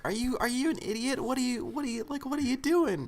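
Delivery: high-pitched imitation of an indignant guy; mock incredulous outrage. Acted quote of angry confronting guy.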